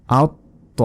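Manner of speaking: আউত ত with heavy t closed, pause, then to; doubled consonant. Shown as a demonstration. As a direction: hold the t of আউত before releasing ত. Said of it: "নিজে নিজে একবার উচ্চারণ হবে". (আউত ত, ত দুইবার আলাদা করে)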